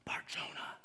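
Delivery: hushed, slow, intense whisper; whispered with awe; serious whisper. Retold or imitated. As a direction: lean in and breathe the name like a secret blessing. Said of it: "excited and s-, and very serious".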